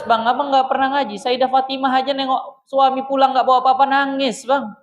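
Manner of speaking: wife reproaching her husband, pitched high; wife talking back. Retold or imitated. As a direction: play the wife scolding her husband for not studying. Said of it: "Nanti dia bales".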